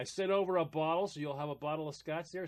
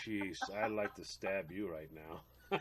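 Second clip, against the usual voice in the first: muttered irritation; 9 semitones lower, 8 dB quieter, slower than usual. Retold or imitated. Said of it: "You're like".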